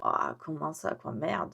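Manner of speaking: soft, dismayed under the breath; muttered dismay. Retold or imitated. Reacting to the clerk's bad news, muttered dismay. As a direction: mutter your shock quietly to yourself.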